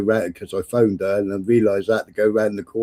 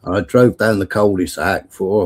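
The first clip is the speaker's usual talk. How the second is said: normal talking voice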